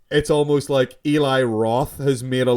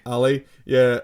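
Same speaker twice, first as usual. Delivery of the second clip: ordinary delivery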